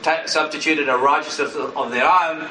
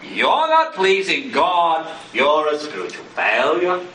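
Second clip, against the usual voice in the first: slow accusing verdict; accusing inner voice; slower than usual. Acted. Preacher voicing the accuser, slow and condemning. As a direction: lay the guilt on slowly. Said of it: "That's not the voice of Jesus".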